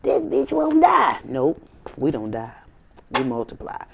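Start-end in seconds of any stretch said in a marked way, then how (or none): 0.0-1.2 very high, drawn-out whine; mock their disbelief; hammed-up shocked rival; exasperated haters. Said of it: "They like".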